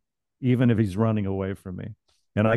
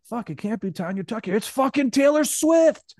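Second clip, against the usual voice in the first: high strained outburst, building louder; dramatic revelation; 11 semitones higher, 4 dB louder than usual. Acted. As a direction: stretch the name out in mock amazement. Acted climactic reveal of the name.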